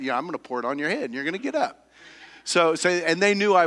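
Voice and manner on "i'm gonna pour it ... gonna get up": quick mock threat. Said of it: "that meant".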